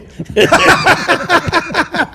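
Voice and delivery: loud, high burst of laughter; big laugh. Real, not acted. Player laughing at his own Leland story, loud and high. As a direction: laugh out hard at the punchline.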